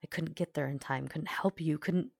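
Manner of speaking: plain reading voice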